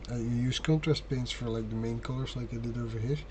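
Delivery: ordinary delivery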